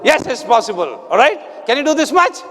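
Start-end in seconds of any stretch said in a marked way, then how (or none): none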